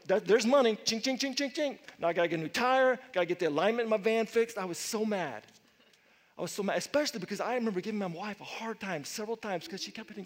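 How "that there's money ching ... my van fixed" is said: loud, fast griping about the repair bill; ching ching as money flies away; annoyed driver tallying the damage aloud; grumbling about cost. Retold or imitated. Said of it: "I was like".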